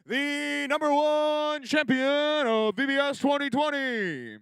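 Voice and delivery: high, slow, booming announcer call. Acted. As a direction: hype it like a fighting game announcer. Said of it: "my announcer voice makes me cough".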